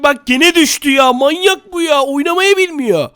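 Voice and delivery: high, slow exasperated whine; exasperated complaint. Real, not acted. Player griping to viewers that his rival fell, exasperated.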